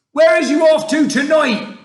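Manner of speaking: booming friendly giant's call; shout. Acted. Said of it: "shouted the B F G".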